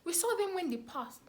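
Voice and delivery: high, loud excited eyewitness claim; eager bystander. Retold or imitated. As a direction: squeal it like a neighbour eager to be a witness.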